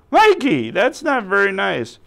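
high, loud mock outrage; playfully scandalized outcry; indignant scolding. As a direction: scold a naughty puppet at full pitch. Host scolds puppet for name-calling.

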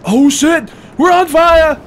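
high, loud burst of alarm; alarmed yell. Real, not acted. Player reacting to fire in the game, alarmed yell. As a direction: yell out in sudden panic.

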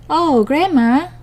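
put-on high, slow wondering girl; little girl voice. A narrator's reading.

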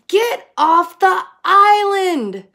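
high, loud, slow shout; shouting frog. A narrator's reading. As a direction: shout him off the island. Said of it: "shouted Rupert".

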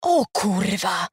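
slow, drawn-out groan of dismay; moaned. A narrator's reading. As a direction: moan the curse slowly. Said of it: "jęknął Patyczak".

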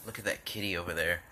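ordinary talk, nothing marked

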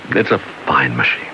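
normal talking voice

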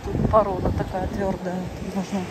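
usual speaking voice

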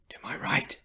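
very soft, breath-only tip-off; covert whisper. A narrator's reading. Terry tipping off companions under spotlights, whispered. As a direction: whisper it so the enemy won't hear.

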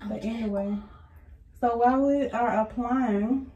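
speaking through fingers at lips; talking while smoothing on face mask; hand over mouth. Real, not acted.

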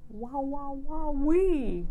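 high, squealing delight; gleeful cheer, pitch way up; giddy wow. Real, not acted. Delighted about younger husband.

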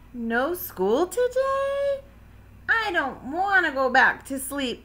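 high, excited little-frog voice; child crying out. A narrator's reading. Froggy protests to his mother about sleep, crying out. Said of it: "cried Froggy".

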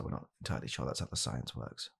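normal talking voice

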